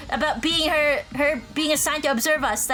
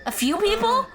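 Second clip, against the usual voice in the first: high, incredulous question; shocked echo; 7 semitones higher than usual. Acted. Learning the secret has spread around, shocked. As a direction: repeat it in disbelief, pitch jumping up.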